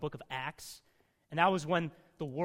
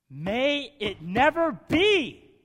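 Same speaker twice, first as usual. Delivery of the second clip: slow, loud, high forceful denial; forceful declaration; 8 semitones higher, 13 dB louder, much slower than usual. Retold or imitated. Preacher giving Paul's slow, loud, forceful refusal. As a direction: thunder it out slowly, with force. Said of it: "this is him with force".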